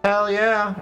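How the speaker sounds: loud, pumped cheer; excited. Real, not acted. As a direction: celebrate the win. Cheers at the outcome.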